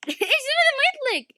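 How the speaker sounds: very high mock-annoyed squeak; squeaky high complaint. Gamer annoyed the move won't fire, very high voice. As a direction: squeal the question in a put-on voice. Frustrated at game control.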